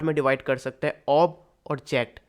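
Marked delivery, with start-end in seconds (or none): 1.1-2.2 "ऑब" and "जैक्ट" said as two separate parts; split into two sounds. Shown as a demonstration. Teacher dividing a word by sound, syllable by syllable. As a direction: break it cleanly: "ऑब", then "जैक्ट". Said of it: "sound को दो part".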